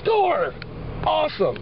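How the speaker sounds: high, delighted cry of joy; excited squeal. Real, not acted. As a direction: shout it out, thrilled. Delighted at pizza crusts.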